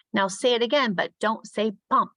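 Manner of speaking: high, deliberate classroom instruction; teacher to class. Shown as a demonstration. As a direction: set the class a little word puzzle. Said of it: "I might say".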